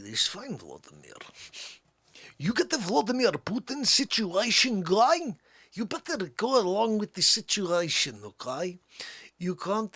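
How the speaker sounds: thick, hammed-up Russian accent. Russian recounting vodka with Vladimir Putin, Russian accent. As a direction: tell it as a comic Russian. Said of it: "when you do the Russian accent".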